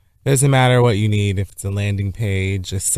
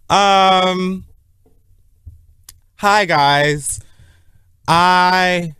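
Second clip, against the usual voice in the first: put-on high voice; 9 semitones higher, 4 dB louder, much slower than usual. Showy put-on greeting voice.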